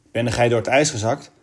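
usual speaking voice

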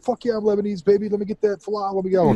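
high-pitched, hammed-up enthusiasm; playful eager reply. Customer sweet-talking cafeteria girl for free dessert, hammed-up eagerness.

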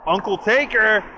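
high, loud call of Uncle Taker; loud greeting. Retold or imitated. Imitating Big E calling the Undertaker, high and loud. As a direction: belt out the name in greeting. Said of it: "Big E just lets out".

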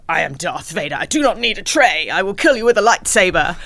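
loud, booming hammed-up Vader; mock Darth Vader. Retelling a comedy sketch, a hammed-up Vader booming at the server. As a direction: bellow it like a pompous Sith lord. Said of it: "And he goes".

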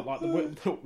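usual speaking voice

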